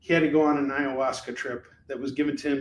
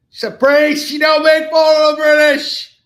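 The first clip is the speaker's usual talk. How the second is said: loud mock outburst; 12 semitones higher, 12 dB louder than usual. Mock outburst about the British.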